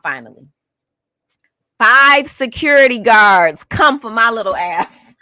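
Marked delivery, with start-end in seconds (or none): 1.8-5.2 slow, loud, higher-pitched outrage; loud disbelief. Real, not acted. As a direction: draw it out loudly in disbelief. Outraged disbelief at five guards.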